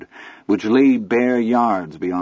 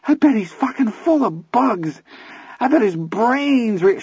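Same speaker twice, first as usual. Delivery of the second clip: put-on manic kid voice; 13 semitones higher than usual. Teddy ranting about bugs.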